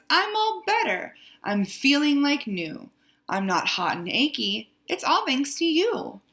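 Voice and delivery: storybook bear crying out happily, pitch leaping up; excited cry. A narrator's reading. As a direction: cry out the good news with bouncy, high peaks. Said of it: "Bear cries".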